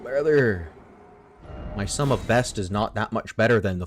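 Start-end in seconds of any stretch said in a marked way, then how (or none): 0.0-0.7 exasperated brother. Real, not acted. Exasperated address to viewer.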